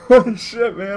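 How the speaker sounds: high, loud laughing "holy shit"; laughing shock. Real, not acted. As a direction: crack up at the absurd danger. Laughing shock at fake chain.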